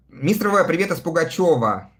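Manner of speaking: usual speaking voice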